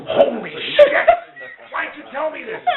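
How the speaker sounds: high, startled cry of alarm; a cop suddenly scared of the mob; shocked. Retold or imitated. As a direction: blurt it out in sudden panic. Cop learns of mob uncle.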